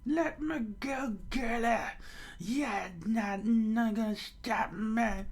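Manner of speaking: high, slow strained protest; hammed-up indignant old man; stubborn old mayor. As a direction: fight free with a drawn-out cry.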